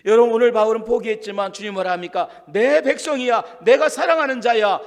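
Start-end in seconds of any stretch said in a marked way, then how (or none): 2.5-4.8 the Lord calling. Acted. The Lord's voice in the sermon.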